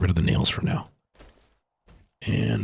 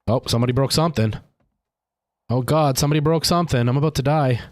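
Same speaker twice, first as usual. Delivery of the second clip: ordinary delivery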